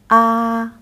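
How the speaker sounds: "ah" held high and level; first tone. Shown as a demonstration. Teacher showing the class a high flat "ah". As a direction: sing "ah" on one high, flat pitch. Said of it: "The first one is high and flat one".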